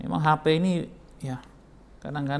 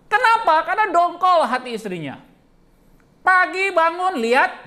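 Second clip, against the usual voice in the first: high, loud comic build-up; excited storytelling; 13 semitones higher, 8 dB louder than usual. Acted. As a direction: build the joke up loudly. Comic build-up about phone. (cerita lucu dengan suara tinggi)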